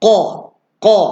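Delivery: "Qa" from the back of the throat, pushed inward; deep qaf sound. Shown as a demonstration. Teacher demonstrates the qaf letter, pushed back in the throat. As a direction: say "Qa" with the tongue root pressed back. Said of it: "dia bunyi ke dalam".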